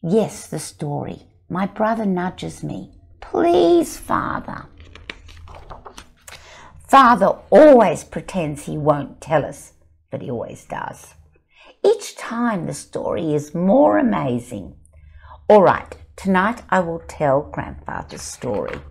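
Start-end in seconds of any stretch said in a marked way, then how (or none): 1.5-2.9 lively picture-book reading; story narration. A narrator's reading. Author reading her book to an audience, animated storytelling. 3.2-4.7 put-on high, eager child's plea; pleading child. Child begging father for a story, high and pleading. 6.9-18.8 lively picture-book reading; story narration. A narrator's reading. Author reading her book to an audience, animated storytelling.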